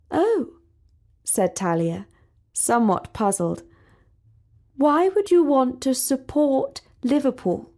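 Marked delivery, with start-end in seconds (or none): 0.1-0.5 high puzzled oh; a lifted, bemused oh. A narrator's reading. Princess puzzled by Sally, high oh. 4.8-7.7 higher, prim, bemused; a hint of the haughty princess; puzzled princess. A narrator's reading. Princess questioning Sally's team, prim.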